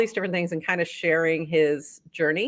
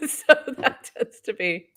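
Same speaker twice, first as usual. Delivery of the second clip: laughing; 10 semitones higher than usual. Real, not acted. Joking about silly bounty category.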